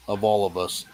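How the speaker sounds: normal talking voice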